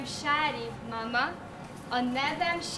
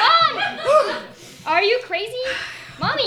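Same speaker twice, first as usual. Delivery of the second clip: shocked cry; 9 semitones higher than usual. Acted. Daughter shocked by mother.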